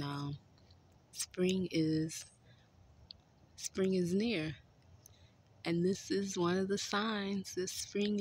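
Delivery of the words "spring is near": usual speaking voice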